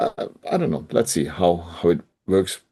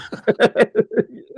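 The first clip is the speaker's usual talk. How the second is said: high laugh. Real, not acted. Laughing at frying pan joke.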